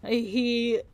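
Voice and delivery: ordinary talk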